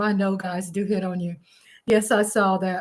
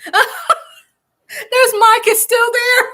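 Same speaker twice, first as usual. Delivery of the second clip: slow, louder words through real laughter; laughing out; 12 semitones higher, 8 dB louder, much slower than usual. Real, not acted. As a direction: laugh while you spot him. Laughing spotting Mike.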